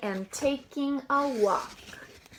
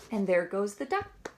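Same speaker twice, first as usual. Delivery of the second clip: ordinary delivery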